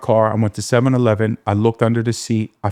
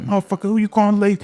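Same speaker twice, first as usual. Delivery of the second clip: quick, high retort thrown back hard; retold snap; 11 semitones higher, much faster than usual. Snapping back at the friend who called him lazy, quick and high. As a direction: fire it back fast, stung by the insult. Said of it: "I snapped".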